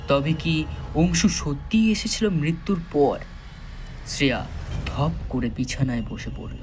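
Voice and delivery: usual speaking voice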